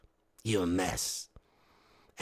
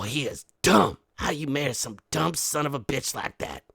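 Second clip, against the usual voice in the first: usual speaking voice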